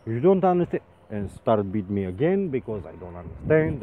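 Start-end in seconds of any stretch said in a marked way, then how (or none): 0.1-0.8 angry wife. Retold or imitated. Wife shouting at him.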